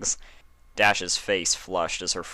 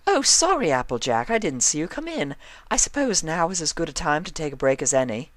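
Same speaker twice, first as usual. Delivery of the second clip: higher, refined fussy lady voice; prim fashionista scolding a fidgety friend; Rarity's voice; 10 semitones higher, 4 dB louder than usual. A narrator's reading.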